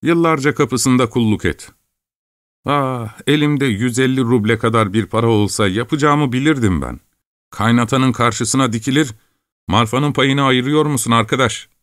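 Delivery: put-on a restless farm boy dreaming of money; young peasant lad. A narrator's reading.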